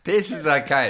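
giggling through it; laughing. Real, not acted. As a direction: crack up at the thought. Laughing about bed-wetting.

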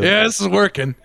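high-pitched retold excitement through amusement; mock triumph. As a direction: call it out as a mock success. Retold shout amid laughter at story.

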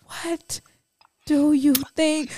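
a husband "yelling" sweetly at his wife; mock gentle yelling. Mocking the husband's too-gentle yell. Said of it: "his yelling to be like".

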